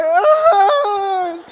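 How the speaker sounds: long, loud, high-pitched wail; mock bawling wail. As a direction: imitate a child wailing at full volume. Daughter bawls and runs off.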